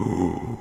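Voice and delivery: drawn-out theatrical gasp; mock shocked gasp. Everyone reacting to Zoe's insult, mock gasp. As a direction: gasp like a scandalized onlooker. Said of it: "Everyone's like".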